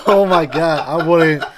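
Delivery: laughing loudly, high and loud; real laughter over shocked words. Real, not acted. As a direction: crack up while getting words out. Reacting to the story.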